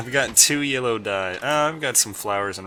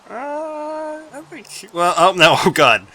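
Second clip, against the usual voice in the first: alarmed; 12 semitones higher, 9 dB louder, slower than usual. Real, not acted. Nearly crashing the boat.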